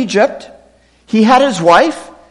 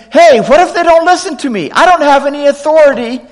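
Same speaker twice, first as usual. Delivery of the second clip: Moses arguing; 6 semitones higher, 3 dB louder, faster than usual. Acted.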